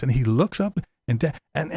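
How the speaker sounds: normal talking voice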